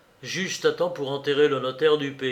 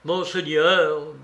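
usual speaking voice

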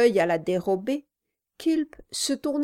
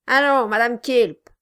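loud, gruff dwarf; 4 semitones higher, 8 dB louder than usual. A narrator's reading. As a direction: bark it like a surly dwarf. The dwarf's voiced lines.

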